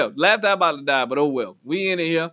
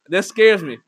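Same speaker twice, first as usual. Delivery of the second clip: normal talking voice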